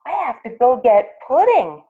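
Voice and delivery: excited yelping. Acted. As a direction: burst out with a new idea. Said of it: "yelped Jitterbug".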